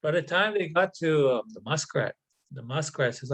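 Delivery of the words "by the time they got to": ordinary delivery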